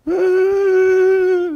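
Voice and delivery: a sudden loud high angry yell. Retold or imitated. Venting anger as a small boy, loud high yell. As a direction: let out a burst of child's rage.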